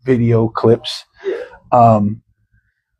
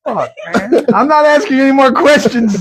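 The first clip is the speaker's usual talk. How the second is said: ordinary talk, nothing marked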